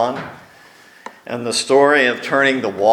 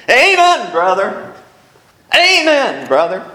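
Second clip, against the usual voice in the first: much higher, louder hallelujah shout; congregation amen; 9 semitones higher, 5 dB louder than usual. Retold or imitated. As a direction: call it out from the pews. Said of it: "someone in the congregation would say".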